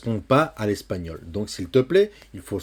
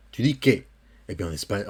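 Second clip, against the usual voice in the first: usual speaking voice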